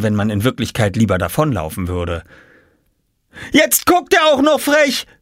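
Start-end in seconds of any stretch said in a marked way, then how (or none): 3.5-5.1 very high indignant shriek; screaming. A narrator's reading. Jule complains about Oskar's cheeky look, screaming. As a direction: shriek it in outrage. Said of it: "schrie Jule".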